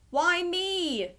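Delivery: high-pitched indignant protest; mild yell. Acted. Ernie objects to cleaning up the mess, mildly yelling. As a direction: call it out high, without much volume. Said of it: "yelled Ernie".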